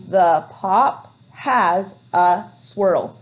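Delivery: The pop has a swirl read very slowly; teacher modelling slow reading; slow reading aloud. Shown as a demonstration. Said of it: "Let's read it smoothly".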